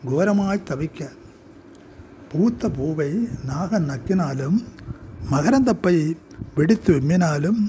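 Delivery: measured poetry reading, pausing after each short phrase; deliberate, declaimed verse with separated phrases; poem recitation. Acted. Poet reads own poem aloud.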